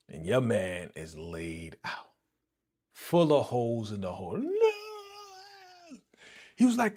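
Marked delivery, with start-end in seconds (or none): 4.4-6.0 long, very high-pitched cry of no; thin, high falsetto scream, held long; mock drawn-out scream. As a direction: imitate a dramatic movie wail. Mimics victim's cry as shots fly.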